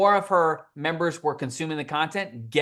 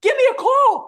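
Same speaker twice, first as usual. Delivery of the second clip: very high, loud indignant cry; mock outrage; 8 dB louder than usual. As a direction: shout it like you can't believe him. Complaining to exterminator.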